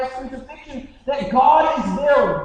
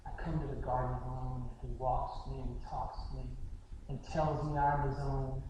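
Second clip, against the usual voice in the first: very soft, low quoted hymn words; hushed, tender recital; 10 semitones lower, 13 dB quieter than usual. Retold or imitated. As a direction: recite it gently, as something that saved you. Retells woman reciting the hymn tenderly.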